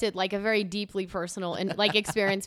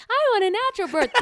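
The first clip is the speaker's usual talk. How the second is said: high, quick mocking whine; husband mocking his wife's birth plan, high and quick; mocking replay; 11 semitones higher, 4 dB louder, faster than usual. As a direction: parrot her words back in a high mocking voice. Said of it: "playing it over and over like".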